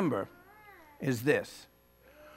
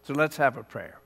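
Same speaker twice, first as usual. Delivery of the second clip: ordinary delivery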